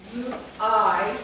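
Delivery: Z, then a long loud I; sounding out. Shown as a demonstration. Teacher showing the vowel in the syllable, loud sounding out. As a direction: hold "Z", then hit "I" hard. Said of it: "Same thing applies".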